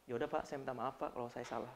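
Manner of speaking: very soft, low reluctant sorry; grudging apology. Retold or imitated. Grudging employee apologising to the boss, soft and low. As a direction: apologise without meaning it. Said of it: "Itu bukan menunjuk maaf".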